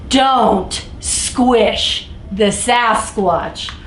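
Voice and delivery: very slow, every word stretched; slow, deliberate storybook refrain; drawn-out refrain. Acted. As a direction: drag the title refrain out for effect.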